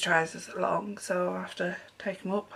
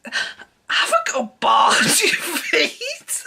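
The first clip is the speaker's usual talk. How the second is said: slow, breaking into helpless laughter; crying with laughter; 8 semitones higher, 6 dB louder, much slower than usual. Real, not acted. Telling viewers her retort, dissolving into tears of laughter. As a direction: laugh so hard the words stretch. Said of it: "Oh my God, I'm crying".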